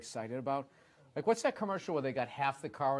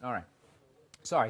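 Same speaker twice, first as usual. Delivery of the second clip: ordinary talk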